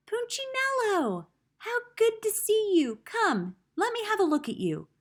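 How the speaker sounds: high, delighted welcome; joyful greeting. A narrator's reading. Eli the woodcarver welcoming Punchinello, delighted and high. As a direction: greet him like a long-lost friend.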